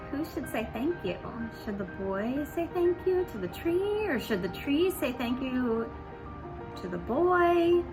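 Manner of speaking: ordinary delivery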